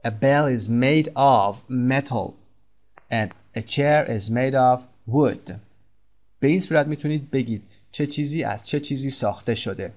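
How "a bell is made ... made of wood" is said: very slow, clear teacher dictation; slow classroom example sentence; slow model sentence. Shown as a demonstration. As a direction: spell each word out slowly for learners.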